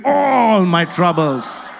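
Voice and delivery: "All" drawn out long and emphatic; "all" with attitude. Shown as a demonstration. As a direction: stretch "All" with bold attitude, slowly. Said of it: "say that all with attitude".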